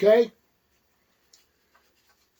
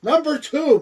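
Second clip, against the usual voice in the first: ordinary delivery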